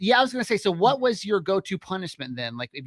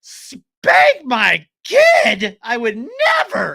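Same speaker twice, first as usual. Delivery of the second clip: hammed-up, drawn-out shout of outrage; appalled parent; 6 semitones higher, 8 dB louder, much slower than usual. Parent friend asked about spanking, hammed-up appalled shout. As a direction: stretch each word in loud mock horror.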